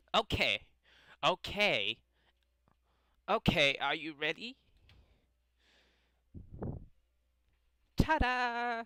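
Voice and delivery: put-on high, loud showy anime-girl voice; Osana. Club girl unveiling her cupcakes to friends, excited high character voice. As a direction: build up to a big high-pitched reveal. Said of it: "like, Osana's voice from Yandere Simulator".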